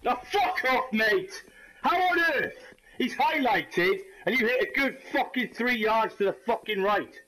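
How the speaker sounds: high-pitched, incredulous complaint; outraged rant. Real, not acted. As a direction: protest the miss in disbelief. Ranting at a missed hit.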